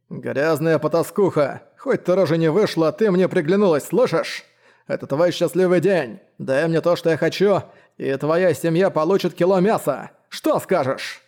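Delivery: leering threat from a brute; sinister villain voice. Acted. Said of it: "зловещий голос". (зловещий голос злодея)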